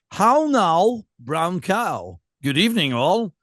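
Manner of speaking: slow, drawn-out showpiece voice; new posh-elocution voice. Pretend. Greeting listeners in a new voice, slow and mannered. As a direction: unveil a carefully enunciated new voice. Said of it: "This is my new voice".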